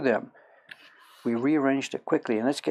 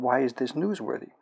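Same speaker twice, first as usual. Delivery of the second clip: usual speaking voice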